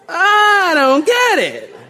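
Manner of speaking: loud, high comic incredulity; exaggerated bafflement. Preacher puzzles over the strange pulpit voice, loudly baffled. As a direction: stretch the last phrase in a high mock wail.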